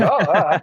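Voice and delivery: imitating a dazed stuntman after the hit; mock dazed groan. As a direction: act out being knocked loopy, half laughing.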